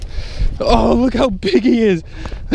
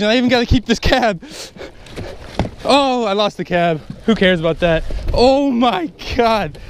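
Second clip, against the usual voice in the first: ordinary talk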